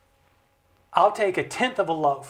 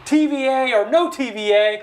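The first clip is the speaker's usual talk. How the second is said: higher, insistent mock demand at the end; mock scolding; 4 semitones higher, 3 dB louder than usual. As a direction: lean on the congressman like an angry voter. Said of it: "he yells and he screams for us".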